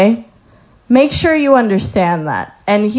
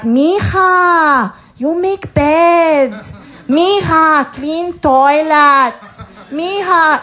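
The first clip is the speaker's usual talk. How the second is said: put-on higher, slow, bossy older lady voice; imitating the Mexican ladies; 6 semitones higher, much slower than usual. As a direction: mimic the cleaning ladies handing out chores, slowly and higher. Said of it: "But they're like".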